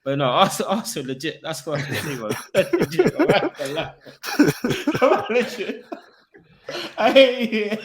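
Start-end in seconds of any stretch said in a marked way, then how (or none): 2.5-7.8 high, loud words through laughter; helpless giggling as you speak; laughing. Real, not acted. Fan cracking up at his own banter, laughing.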